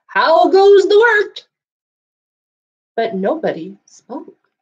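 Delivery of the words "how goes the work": much higher, louder, slow call; farmer calling. Acted. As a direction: bellow the farmer's question. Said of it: "expecting to hear".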